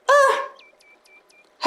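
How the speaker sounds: high strained grunt; stumped boar. A narrator's reading. Wild boars asked by the fox, hesitant grunt.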